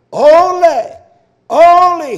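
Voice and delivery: "only" shouted high, each one held; emphatic repetition. Real, not acted. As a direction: hammer "only" as a loud, high cry. Hammers the scripture word for emphasis.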